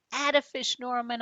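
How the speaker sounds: loud, high happy cheer. A narrator's reading. Boy cheering his goldfish after the tricks, high and loud. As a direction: cheer the fish on. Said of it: "I cheer".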